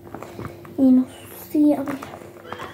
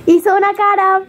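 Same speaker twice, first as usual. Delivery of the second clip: excited teasing; 8 semitones higher than usual. Real, not acted. Catching her sister's face.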